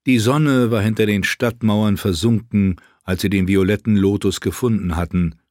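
plain reading voice

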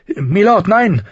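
high, loud urgent plea; agitated cry. A narrator's reading. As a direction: call out in high-pitched alarm. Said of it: "rief eine aufgebrachte Stimme".

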